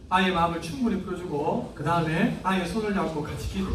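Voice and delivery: ordinary delivery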